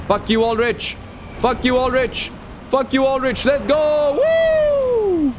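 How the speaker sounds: high-pitched celebratory chant building to a whoop; triumphant cheering. Real, not acted. Gamer taunting a beaten boss, triumphant cheering. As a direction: cheer your victory, voice climbing high.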